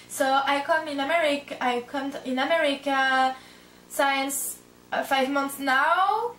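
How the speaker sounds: English in a slow French accent, words drawn out; careful, stretched-out French-accented English. Shown as a demonstration. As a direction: show your halting English with a strong French accent. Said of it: "mon accent français est toujours très présent".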